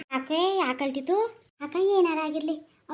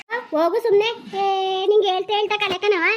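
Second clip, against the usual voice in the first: higher, louder brush-off; angry dismissal; 6 semitones higher, 5 dB louder than usual. Acted. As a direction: snap at her to go. Angry dismissal, loud high.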